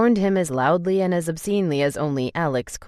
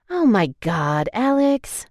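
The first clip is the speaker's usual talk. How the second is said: slow, then high whine; exasperated; 6 semitones higher, 3 dB louder, slower than usual. A narrator's reading. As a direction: roll your eyes at him. Sophia exasperated at brother.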